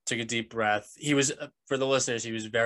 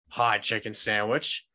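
usual speaking voice

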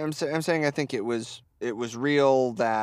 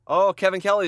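excited; 7 semitones higher, 7 dB louder, faster than usual. Real, not acted. Reacting to the commentator.

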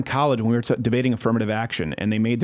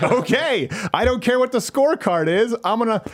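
gloating quote; 8 semitones higher than usual. Retold or imitated. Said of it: "And I went".

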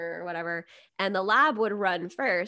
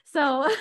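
high burst of laughter; laughing; 10 semitones higher, 6 dB louder than usual. Real, not acted. Laughs at herself.

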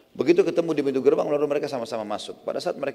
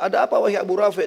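higher, louder put-on voice; disguised voice; 6 semitones higher, 5 dB louder than usual. Abdullah luring Abu Rafi' in the dark, disguised voice. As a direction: call out in a changed voice, raised in pitch. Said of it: "dengan suara yang berbeda".

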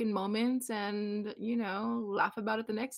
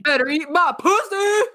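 mock sexy shout; 8 semitones higher, 9 dB louder than usual. Crude joke shouted at guests.

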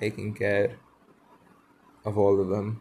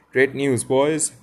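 higher, louder announcement; excited cry; 4 semitones higher, 9 dB louder, slower than usual. A narrator's reading. Peter tells boys the glorious tidings, crying out. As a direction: call out glad news, lightly acted. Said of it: "he cried".

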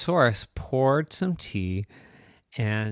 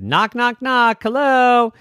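calling out knock knock; 14 semitones higher, 11 dB louder than usual. Acted. Calling at neighbours' door.

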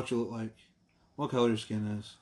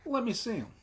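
much higher, softer mimic of a demanding mom; mock mom demand; 12 semitones higher, 3 dB quieter than usual. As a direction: ask to see them in a high put-on mom voice. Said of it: "She's like".

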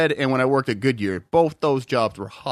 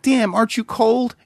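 incredulous; 8 semitones higher than usual. Retold or imitated. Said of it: "And I was like".

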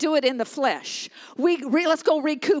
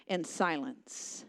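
hushed; 7 semitones lower, 10 dB quieter, much slower than usual. Acted. Stresses the silence.

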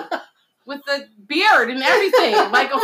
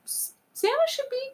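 mocking voice; 6 semitones higher than usual. Mocking a poll voter.

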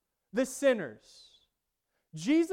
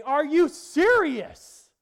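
slow, loud, high-pitched cry to the sky; a retold furious yell at God; 10 semitones higher, 12 dB louder, much slower than usual. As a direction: hurl it up at heaven in disbelief. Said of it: "I still remember yelling at God".

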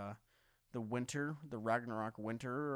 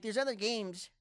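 high-pitched mock complaint; whiny put-on imitation, pitched up; mocking Cool Me; 8 semitones higher, 3 dB louder than usual. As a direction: mimic a nagging complainer, higher pitched. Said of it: "this is what Cool Me sounds like".

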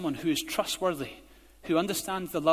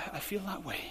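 whispered inner voice; 10 dB quieter than usual. Pretend. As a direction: breathe it like a private thought. Quoting inner feeling.

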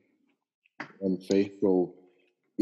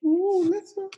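high excited squeal; excited ooh. Real, not acted. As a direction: squeal the place name in delight. Reacting to a guest's hometown.

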